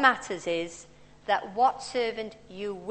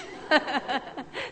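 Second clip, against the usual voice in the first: a brief real laugh at the story; short laugh; 5 semitones higher than usual. Real, not acted. Preacher laughing at the boy's verdict on her sermon, short laugh. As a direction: laugh it off, amused.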